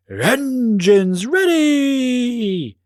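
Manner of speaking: long, drawn-out high call; yelling. Acted. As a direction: call it out to the whole stadium. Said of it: "yelled Paige".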